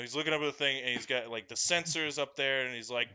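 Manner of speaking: ordinary delivery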